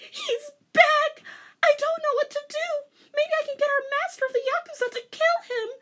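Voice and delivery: high loud crying, rushing at the end; tearful panic. A narrator's reading. Rei panicking to her teacher, crying. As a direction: sob it in panic.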